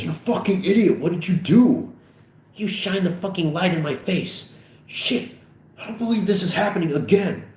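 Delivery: high, frantic scolding; upset, high-pitched blaming; furious panic. Acted. Acted blame after crash.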